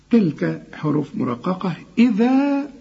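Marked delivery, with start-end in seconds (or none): 2.0-2.7 إذا with the final alif held full and high. Shown as a demonstration. Teacher showing the pause on إذا, full high alif. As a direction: stretch the alif of إذا fully. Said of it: "تديها ألف كاملة".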